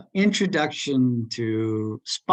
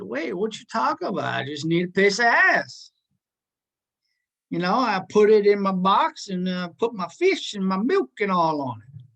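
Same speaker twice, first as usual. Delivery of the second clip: higher, louder, put-on country voice; country boy; 7 semitones higher, 4 dB louder than usual. Country boy confused by the hardware store clerk, higher and louder. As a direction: play the puzzled country boy, a bit indignant. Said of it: "he was a country boy from Alabama".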